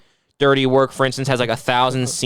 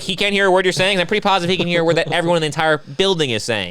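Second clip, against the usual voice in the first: ordinary delivery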